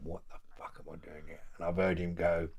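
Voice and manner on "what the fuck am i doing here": panicked whisper under breath. Retold or imitated. Inner panic in cell.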